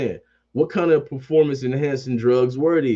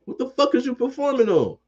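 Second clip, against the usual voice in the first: incredulous high yell; 11 semitones higher than usual. Real, not acted. Ranting at Jimmy G.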